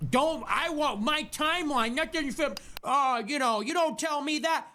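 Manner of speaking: high, far-off mocking voice of her; mocking impression. Streamer mocking Candace Owens's protests, in a high sneering voice.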